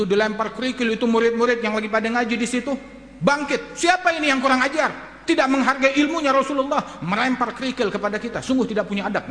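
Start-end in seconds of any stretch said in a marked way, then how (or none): 3.8-7.0 high-pitched acted outrage at an offender; indignant scolding. Pupils hit by gravel scold the thrower, indignant. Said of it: "ngomel semuanya di majelis".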